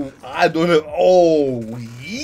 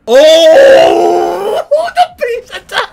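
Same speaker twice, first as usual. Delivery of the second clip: excited shout; 14 semitones higher, 12 dB louder, much slower than usual. Real, not acted. Hype moment in reaction video.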